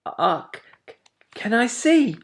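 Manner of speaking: high, hesitant little owlet; a shy, eager little ask; small voice. A narrator's reading. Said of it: "said a small voice".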